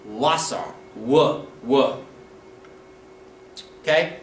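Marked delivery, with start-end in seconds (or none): 0.0-2.0 "Wasser" with an English w, low; "Wasser" opening like English "water"; Pennsylvania Dutch W. Shown as a demonstration. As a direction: sound out "w" low after "Wasser". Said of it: "make a W sound".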